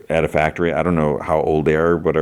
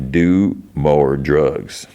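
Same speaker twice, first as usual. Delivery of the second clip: very slow, stretched delivery; drawn-out advice; much slower than usual. Retold or imitated. He offers her the alternative to withdrawal, drawn out slowly. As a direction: draw each word out deliberately.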